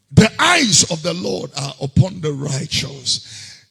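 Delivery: ordinary talk, nothing marked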